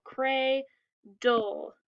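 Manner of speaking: "cray, dole" as two separate beats; split into syllables. Shown as a demonstration. Teacher recapping two syllables, word pulled apart. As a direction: leave a clear pause between "cray" and "dole". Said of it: "We have two syllables".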